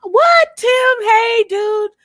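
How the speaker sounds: indignant squeal of disbelief; shocked high outburst. Real, not acted. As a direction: pitch leaps high and loud in disbelief. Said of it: "my spirit is fucking livid".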